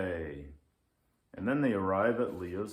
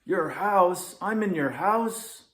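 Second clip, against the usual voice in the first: much higher, loud shocked squawk; 10 semitones higher, 9 dB louder than usual. Acted. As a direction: yelp it in alarm. Lynx shocked to be there.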